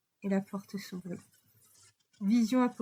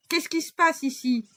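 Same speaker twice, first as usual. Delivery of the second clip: fort et haut, autoritaire; grosse voix; 8 semitones higher, 6 dB louder than usual. A narrator's reading. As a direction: imposer sa présence. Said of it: "cette grosse voix". (Laurent le policier, grosse voix forte)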